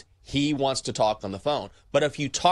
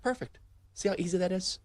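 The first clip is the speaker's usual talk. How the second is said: much higher, softer sing-song praise; mock praise; 8 semitones higher, 5 dB quieter, faster than usual. Host praising handyman for finally planning, sing-song mock praise.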